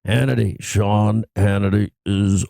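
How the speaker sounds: deep, drawn-out morning announcer; groggy low radio drop voice; Scott Shannon. Acted. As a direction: stretch it out low and slow. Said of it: "it has that morning voice deepness to it".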